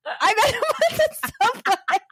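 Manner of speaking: high, breaking up with laughter; laughing protest. Retold or imitated. She retells defending herself to her husband, laughing hard. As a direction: squeal out the retold excuse mid-laugh. Retold excuse, teller cracking up.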